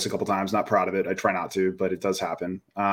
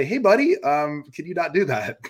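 high, gentle ask; calm gentle dad; 5 semitones higher than usual. Retold or imitated. Dad calmly correcting his three-year-old son, gentle high voice. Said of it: "I'm calm and I say".

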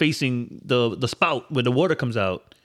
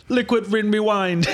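put-on Jamaican accent; 5 semitones higher, slower than usual. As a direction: call it out in mock Jamaican patois. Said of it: "Jamaican, Gas, Jamaican".

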